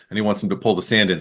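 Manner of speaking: ordinary delivery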